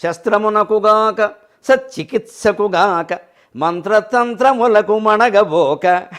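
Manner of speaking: slow, drawn-out verse chanting; poem recitation. Acted. Preacher reciting Joshua's verse to the audience, slow and chanted. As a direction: chant it slowly, savouring each word.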